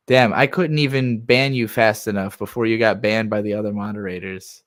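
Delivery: usual speaking voice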